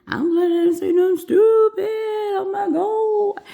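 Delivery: hammed-up high-pitched relief; exaggerated silly voice. Nurse relieved she said nothing stupid, comically exaggerated. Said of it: "talk like that".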